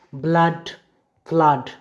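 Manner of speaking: ब्लड, फ्लड set apart with a short open आ; short आ demo. Shown as a demonstration. Teacher gives rule examples, saying ब्लड, फ्लड with short आ. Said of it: "मतलब short आ".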